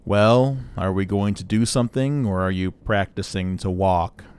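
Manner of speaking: impatient robot monotone; sarcastic droning, slowing at the end; Marvin the paranoid android. Acted. Droid needles the loitering player, sarcastic monotone. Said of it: "this is very clearly Marvin the paranoid android".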